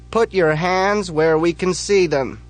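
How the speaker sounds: high, loud, slow police order; police shout. Acted. Police yell at Big Bob, loud shouted command. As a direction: order him to freeze at gunpoint.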